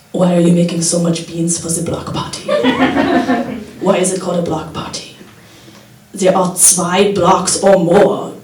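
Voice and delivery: put-on quiet, mild German accent. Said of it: "my impression of German dad".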